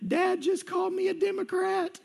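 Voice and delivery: put-on high, wailing little girl voice; mock crying girl. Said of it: "she's screaming and she's crying".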